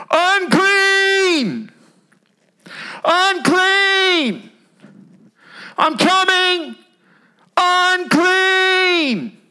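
high, anguished cries of unclean; leper crying out. Retold or imitated. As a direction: call out your shame to the street.

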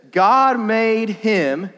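slow, weighty reading of "God made him"; slow scripture reading. Acted. Preacher reading the verse to the youth, slow and weighty. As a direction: lean on "God", slow and deliberate.

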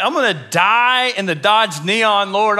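mock-panicked cry, slow and high; freaking out. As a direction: act out a comic meltdown. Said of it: "I'm, like, freaking out".

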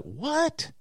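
very high astonished what; stunned what. Retold or imitated. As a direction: react in total disbelief. Stunned teen.

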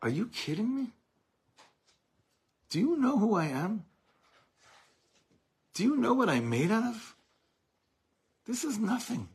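high, far defiant voice scoffing at the mockers; defiant laughing retort. Answering the mockers who laugh at us, high defiant scoff. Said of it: "we'd laugh in their face and say".

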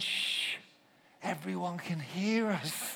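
soft, hissed shush then slow hushed words; wife whispering to her husband to keep it down; hushing whisper. Retold or imitated. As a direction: hush him urgently under your breath. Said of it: "my wife said".

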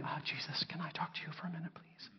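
unvoiced, fast, polite whisper; hushed, meek whispered request. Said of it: "not coming and saying".

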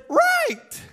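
very high, mock-sarcastic "Right"; a kid's dry, doubtful brush-off; sarcastic teen. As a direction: roll your eyes as an unconvinced teenager. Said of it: "they should have looked at me and said".

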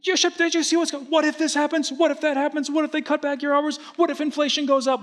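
very high, put-on talking-wallet voice; comic high-pitched voice of fretful money. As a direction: squeak like a nagging, worrying wallet. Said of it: "do the impression of money".

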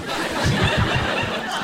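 high breathy burst of laughter; laughing. Real, not acted. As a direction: let the laugh break out. Laughing at own story.

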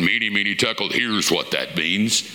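low, mock-divine announcement; voice of God. Preacher imagining God interpreting the writing himself, deep mock God voice. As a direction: sink deep into a playful God impression. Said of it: "You think God would have gone".

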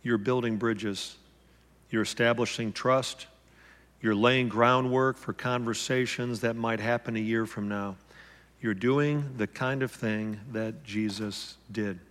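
soft, slow and hushed, like a gentle inner voice; a hushed, tender inner voice reassuring him; gentle whisper. Retold or imitated. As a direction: speak it quietly, as the Spirit's gentle nudge. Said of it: "the gentle whisper of the Holy Spirit saying".

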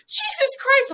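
high, loud laughing yelp of shock; shocked exclamation. Retold or imitated. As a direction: squeal it out, stunned by the slap. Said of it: "And she was like".

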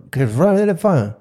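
mock garbled yell, high and loud; muffled shouting imitation. Husband mimics coworker hollering through the bathroom door, muffled mock shout. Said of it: "I don't know what he's saying".

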